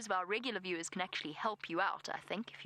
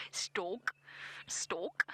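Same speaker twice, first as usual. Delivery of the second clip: whisper-test the word "stork" in a South African accent. Shown as a demonstration. Safari guide to viewers, trying out "stork", South African accent, hushed. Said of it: "especially in a South African accent".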